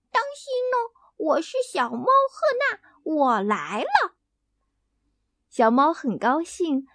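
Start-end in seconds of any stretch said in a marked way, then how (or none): 0.1-4.2 high, loud little hen pretending to be a fierce cat; loud hen playing cat. Hen Hena warning the ducks, high and loud. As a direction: squawk it out like a tiny hen being scary. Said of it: "大声叫着".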